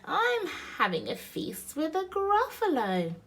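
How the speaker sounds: lightly voiced, high-pitched mouse; mouse character. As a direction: play a small mouse announcing grand plans. Mouse boasts to the snake.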